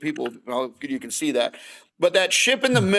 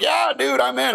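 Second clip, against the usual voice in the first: much higher, excited yes; eager agreement; 10 semitones higher than usual. Retold or imitated. Man jumping at an invite to guys night, eager. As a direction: jump at it in a high, keen voice.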